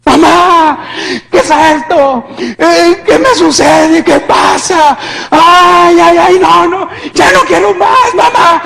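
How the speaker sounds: high, loud wailing pleas; frantic tearful shouting; agonized suffering. Retold or imitated. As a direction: cry out for mother in unbearable pain. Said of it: "yo estaba sufriendo tanto".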